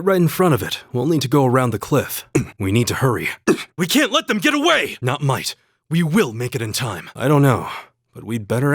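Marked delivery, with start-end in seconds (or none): 3.8-5.0 urgent call. Acted.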